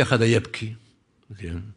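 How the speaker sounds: ordinary talk, nothing marked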